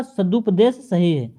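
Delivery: ordinary talk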